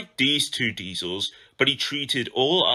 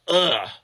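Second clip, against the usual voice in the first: a higher, louder shudder of disgust; disgusted ugh; 6 semitones higher, 4 dB louder than usual. A narrator's reading. Haughty diesel shudders at steam engines, disgusted ugh. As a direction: recoil with a prissy ugh. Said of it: "He shuddered delicately".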